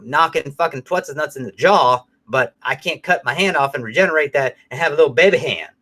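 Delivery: usual speaking voice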